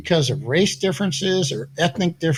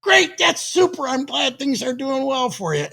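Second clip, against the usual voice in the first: light high mock praise; 8 semitones higher than usual. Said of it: "they go".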